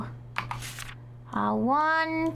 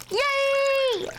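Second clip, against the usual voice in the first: excited; 11 semitones higher than usual. Real, not acted. Cheering at the ramen.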